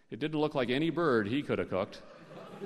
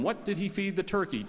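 usual speaking voice

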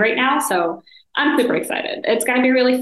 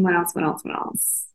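fading to a murmur; 2 semitones lower, 5 dB quieter than usual. Real, not acted. Mutters to self, fading.

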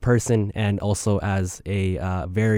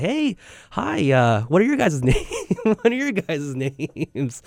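awkward self; 7 semitones higher, slower than usual. Retold or imitated. Awkward greeting to strangers.

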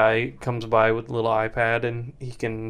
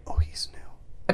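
whispering; 6 dB quieter, slower than usual. Pretend. Whispering to strangers in lounge.

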